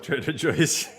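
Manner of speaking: Joe's as "Joyce", with a laugh; amused, turn Joe's into JOYCE; funny mispronunciation. Shown as a demonstration. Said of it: "I'll forever call it".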